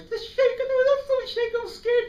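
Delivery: put-on scared child voice. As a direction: squeak it out like a terrified nine-year-old. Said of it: "I was so scared".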